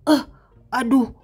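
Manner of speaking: sneak and murmur to yourself; hushed scared heroine. Acted. Sakura sneaks past zombies alone.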